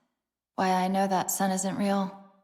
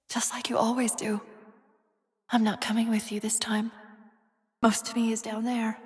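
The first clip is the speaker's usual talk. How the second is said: whisper. Acted. Saying goodbye to John, tender whisper.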